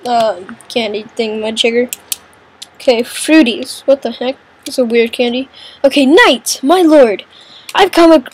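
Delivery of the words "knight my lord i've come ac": usual speaking voice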